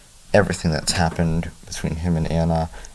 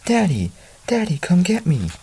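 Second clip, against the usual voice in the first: put-on high-pitched little boy pleading; small child calling for rescue, pitched way up; crying child's call; 12 semitones higher than usual. A narrator's reading. Lost boy begs his father to rescue him, child's call. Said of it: "weak from crying".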